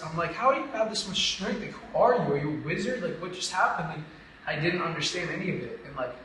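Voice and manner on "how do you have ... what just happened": raised, high-pitched incredulous questions; re-enacted amazed outburst; retold astonishment. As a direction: marvel at him in baffled disbelief. Retells astonishment at the preacher.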